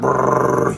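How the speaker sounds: low rolled brrr; helicopter noise. Acted. Storyteller as his friend flies off, imitated rotor noise. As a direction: trill it like rotor blades.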